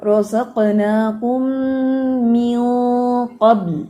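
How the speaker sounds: رزقناکم من قبل with heavy nasal ikhfa before قاف, slow; slow tajweed recitation. Shown as a demonstration. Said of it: "یہاں پہ heavy اخفا ہوگا".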